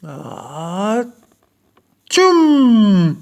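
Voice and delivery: loud, high ah-tchoum; big sneeze. A narrator's reading. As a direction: sneeze hard enough to burst the mitten. Mouse sneezes.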